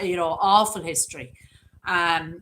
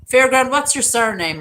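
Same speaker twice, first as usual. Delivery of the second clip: cheery call-out; 6 semitones higher, 9 dB louder than usual. Real, not acted. Calling out to a viewer.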